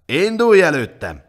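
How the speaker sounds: high, loud order; guard bellowing a marching order; shouted command. A narrator's reading. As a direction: shout him forward. Roaring guard drives Mátyás on.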